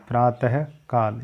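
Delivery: प्रातःकाल slowly with the visarga; drawn out प्रातःकाल; slow correct form. Shown as a demonstration. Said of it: "ये right होगा". (प्रातःकाल धीमा सही उच्चारण)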